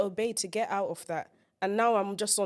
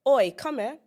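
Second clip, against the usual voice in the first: London Oi; 5 semitones higher, 7 dB louder than usual. Acted. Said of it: "My line was".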